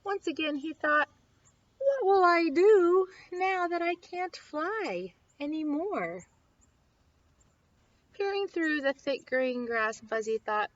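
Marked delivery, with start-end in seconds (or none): 1.8-6.2 sad. Acted. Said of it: "Sadly, he remembered".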